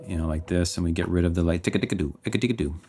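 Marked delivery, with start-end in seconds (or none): none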